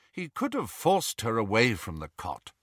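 ordinary delivery, nothing marked

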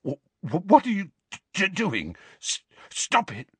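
high, stammering fright; panicky, stuttering plea; terrified. A narrator's reading. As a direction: stutter it out in terror. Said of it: "Dudley's terrified voice".

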